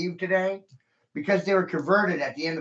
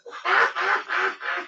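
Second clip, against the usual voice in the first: triumphant cackle; 13 semitones higher than usual. Real, not acted. Gloating after rant.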